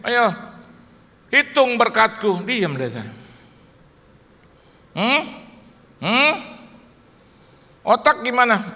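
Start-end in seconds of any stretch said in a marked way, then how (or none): 0.0-2.4 louder, slow commanding call; voice of the Spirit. Retold or imitated. Spirit urges complaining preacher, louder and slow. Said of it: "itu roh ngomong sama saya. Ngomongnya begini". 5.0-8.7 louder, higher probing questions; the Spirit quizzing him playfully; Spirit questioning. Retold or imitated. Spirit checks preacher's body, raised probing voice. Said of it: "roh ngomong sama saya".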